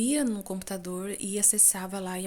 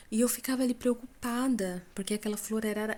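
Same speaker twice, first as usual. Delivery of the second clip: usual speaking voice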